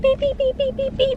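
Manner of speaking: high, quick mock sensor beeps; imitated parking beeps. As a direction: squeak it out like a car sensor. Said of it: "it just goes beep".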